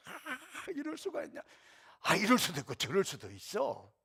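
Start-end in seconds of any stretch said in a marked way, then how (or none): 0.1-1.4 mock outrage. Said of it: "막 참지 못하고 막 분노해가지고".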